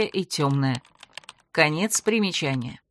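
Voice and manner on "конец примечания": plain reading voice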